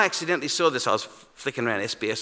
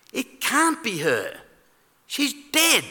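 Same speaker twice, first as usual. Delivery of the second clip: mock alarm; 10 semitones higher, slower than usual. Imagining dead mother walking in.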